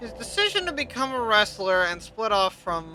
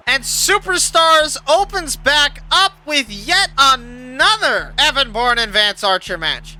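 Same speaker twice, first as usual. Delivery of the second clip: higher, slow, punched-out intro; show announcer; 4 semitones higher, 4 dB louder, slower than usual. Real, not acted. Host brings the show back for another match, announcer delivery. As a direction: stretch it out to introduce the next bout.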